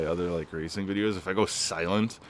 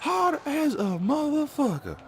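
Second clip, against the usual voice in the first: squeaky falsetto squeal, much higher; squealing mock answer. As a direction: shriek the punchline in a cartoon squeal. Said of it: "And I'm like".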